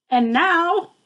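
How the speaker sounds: high, loud announcing cry; excited cry. Acted. Max crying out to start the rumpus. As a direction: call out like a boy king. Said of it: "cried Max".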